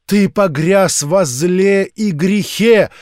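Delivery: high, loud, slow preaching voice; fervent street-preacher sermon; zealous old preacher. A narrator's reading. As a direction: warn him of doom like a revival preacher.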